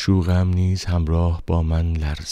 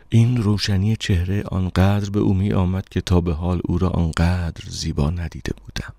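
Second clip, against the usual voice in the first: ordinary delivery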